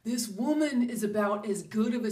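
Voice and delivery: slow, high, loud "Oh my gosh"; shocked. Retold or imitated. Hearing a bad singer in a store, shocked. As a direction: gasp it out in disbelief. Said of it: "I'm like".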